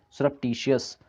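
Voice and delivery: ordinary talk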